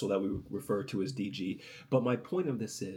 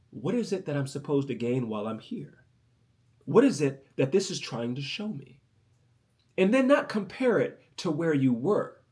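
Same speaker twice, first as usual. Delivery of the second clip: normal talking voice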